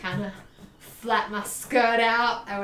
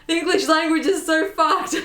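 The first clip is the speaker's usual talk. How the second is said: higher, louder, laughing through it; laughing exasperation; 7 semitones higher, 6 dB louder than usual. Real, not acted. Cursing English spelling to viewers, laughing. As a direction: vent at English while laughing.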